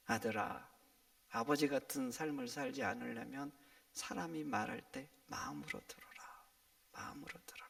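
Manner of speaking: low, hushed plea to a son; a father's soft deathbed counsel; dying father's last words. Acted. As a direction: fade almost to a whisper. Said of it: "죽음이 가까웠을 때 자기 아들을 불러요".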